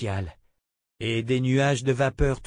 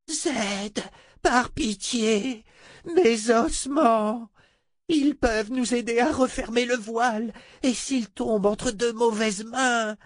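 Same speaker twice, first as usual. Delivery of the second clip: begging sorceress; 11 semitones higher, slower than usual. Acted. Adi begs.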